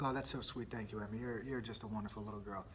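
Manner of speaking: soft, low and tender; tender dad. Retold or imitated. Dad sweetly thanking his daughter, soft and low. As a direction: gently praise a little girl. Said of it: "I'm like".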